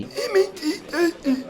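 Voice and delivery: hammed-up tearful wail; mock sob. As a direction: whimper it like a weepy old bloke. Old age, said weepy.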